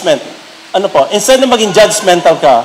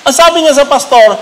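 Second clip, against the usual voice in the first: usual speaking voice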